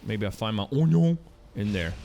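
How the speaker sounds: ordinary talk, nothing marked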